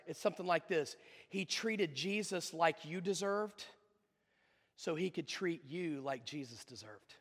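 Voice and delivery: low, soft, measured pulpit voice; solemn preaching. Acted. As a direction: drop low and let the weight sink in. Sermon drops to solemn hush.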